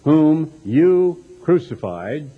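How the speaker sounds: usual speaking voice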